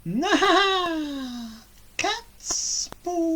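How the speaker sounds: high witch voice with a laugh. Acted. Baba Yaga chuckling over the spoons, high witch voice. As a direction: cackle through it greedily.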